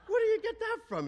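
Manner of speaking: very high, loud incredulous retort; indignant protest. Retold or imitated. Father protesting to his mother-in-law, indignant. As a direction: imitate a squawking, affronted father.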